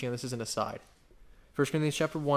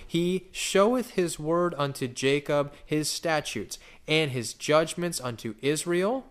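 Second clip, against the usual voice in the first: slow, louder psalm recitation; scripture reading; 4 dB louder, slower than usual. Acted. Preacher reading the psalm to the congregation, slow and solemn. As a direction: read the psalm with full weight.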